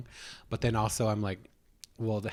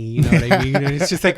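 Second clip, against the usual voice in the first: laughing; 4 semitones higher, 12 dB louder, faster than usual. Real, not acted. Laughing while telling friend story.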